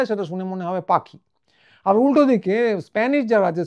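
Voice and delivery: ordinary talk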